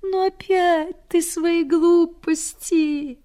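a sickly lady snapping back, slowed; peevish, drawn out; irritated retort. Retold or imitated. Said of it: "Раздражительно так".

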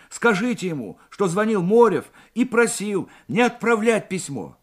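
high, insistent plea over the phone; urgent request. A narrator's reading. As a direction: press the operator to pass it on. Anxious Morev begs operator for help. (взволнованная, настойчивая просьба)

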